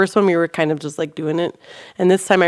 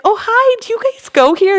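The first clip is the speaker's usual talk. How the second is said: high, louder, eager hello; bubbly greeting; 14 semitones higher, 5 dB louder than usual. Retold or imitated. Greeting the scarecrow kid's mom, high and eager. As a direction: greet strangers with bright enthusiasm. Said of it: "So I'm like".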